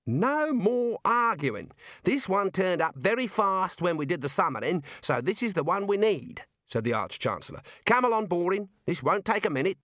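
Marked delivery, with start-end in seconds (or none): 0.1-6.4 overruling Arch-Chancellor. Acted. The Arch-Chancellor overrules the small wizard, insistent. 7.8-9.8 overruling Arch-Chancellor. Acted. The Arch-Chancellor overrules the small wizard, insistent.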